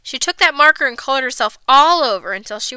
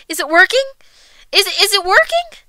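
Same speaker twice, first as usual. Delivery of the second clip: higher-pitched anxious call; mother yelling; 7 semitones higher, 4 dB louder than usual. A narrator's reading. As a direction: call through a door, voice rising. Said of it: "stood outside the door and yelled".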